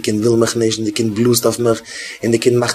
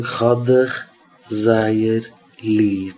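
slow, softening toward the end; tender plea; 3 dB quieter, slower than usual. Acted. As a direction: tell a child gently, word by word. Models gentle words to a child. (ווייך און ליבלעך)

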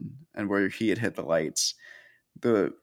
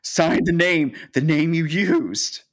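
higher, louder panicked hiss of an imitated wife; hammed-up panic, urging him on; mock panic; 8 semitones higher, 4 dB louder than usual. Helen urges Danny at the bank counter, mock panicked. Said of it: "Helen is truly panicked".

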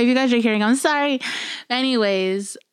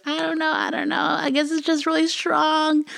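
feigned innocence; 9 semitones higher than usual. Retold or imitated. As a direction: squeak out an innocent shrug. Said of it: "I was like".

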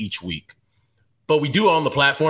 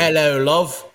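British greeting, playfully put on; mock British accent; 3 semitones higher, 4 dB louder, slower than usual. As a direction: try out a British accent for the trip. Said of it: "practicing his British accent".

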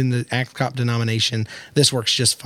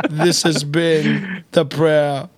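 laughing; much slower than usual. Real, not acted. Laughing after the prayer reading.

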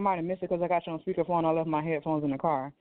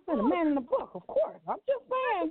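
high, playful teasing; 9 semitones higher than usual. Real, not acted. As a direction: tease with a high lilt. Playful teasing about the Bible.

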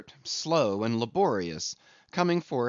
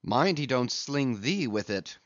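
ordinary delivery, nothing marked

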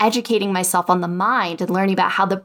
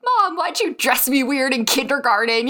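put-on higher, childlike plea to mom; whiny inner child; 5 semitones higher than usual. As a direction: sound like a kid asking mom. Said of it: "being like".

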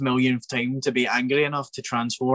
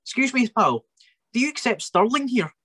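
much higher, comic squeaky pal voice; imitating Lucha; 13 semitones higher than usual. Mocking his friend asking the Tesco cashier, high comic voice. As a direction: play the clueless mate, pitched way up.

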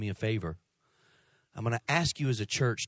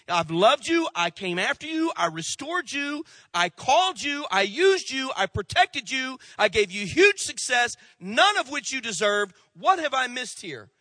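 high, loud, pleading preacher's cry; full-force preaching; 12 semitones higher, 5 dB louder than usual. Acted. Preacher voicing God's hurt to the congregation, loud and high.

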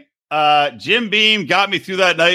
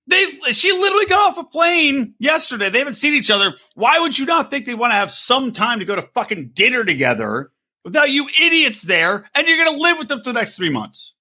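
outraged rant; 8 semitones higher than usual. Real, not acted. Ranting about the parents crashing the couple's dinner, outraged.

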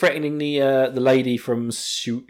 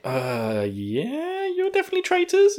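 suspicious. Retold or imitated.

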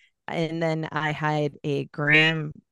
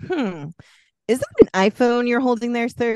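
sarcastic; 7 semitones higher, 5 dB louder than usual. Retold or imitated. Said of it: "I'm like".

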